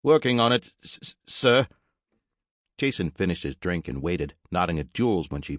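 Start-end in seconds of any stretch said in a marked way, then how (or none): 0.0-1.7 high stuttering robot; malfunctioning robot servant, raised pitch; robot speech impediment. Acted. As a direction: get stuck on the consonants like a glitching machine. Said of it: "fix your goddamn speech impediment".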